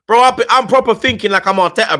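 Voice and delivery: loud, high-pitched excited burst; worked-up outburst. Real, not acted. Host getting inside the manager's head, worked-up outburst.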